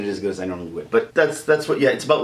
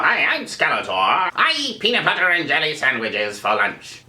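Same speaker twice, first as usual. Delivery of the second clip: cartoon villain timbre, everyday inflection; Skeletor without personality; 4 semitones higher than usual. Shown as a demonstration. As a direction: do the villain's voice but leave out his character. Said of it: "it just doesn't have his personality".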